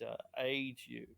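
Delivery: ordinary delivery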